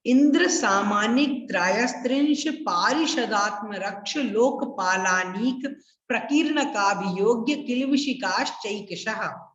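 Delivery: the whole sutra run together without pauses; said in one go. Shown as a demonstration. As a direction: recite it all in one breath. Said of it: "मैं एक साथ बोल रहा हूं". (एक साथ लगातार बोलना)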